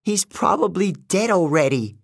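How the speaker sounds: a touch of despair, close to crying; tearful. A narrator's reading. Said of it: "Selena started to cry".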